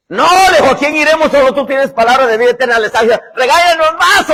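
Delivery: loud, high fiery preaching; soaring pulpit cry; preaching at full force. Real, not acted. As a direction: lift the crowd with it.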